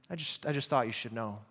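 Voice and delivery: hushed, low, self-important concern; mock noble. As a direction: say it softly, with pretend nobility. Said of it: "That sounds so noble".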